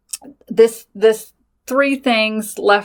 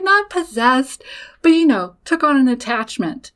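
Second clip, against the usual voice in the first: a hint of mom; 5 semitones higher than usual. Mom correcting her daughter, lightly voiced and patient.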